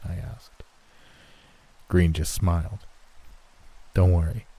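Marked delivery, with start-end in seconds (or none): none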